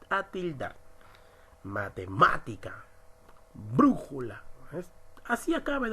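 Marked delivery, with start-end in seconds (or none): 1.6-2.8 Matemática with "má" punched; stress on antepenultimate syllable. Shown as a demonstration. Teacher giving an esdrújula example, Matemática stressed on "má". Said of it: "la mayor fuerza de voz". 3.5-4.4 brújula with a strong "brú"; stress on antepenultimate syllable. Shown as a demonstration. Teacher giving an esdrújula example, brújula stressed on "brú". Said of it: "la mayor fuerza de voz".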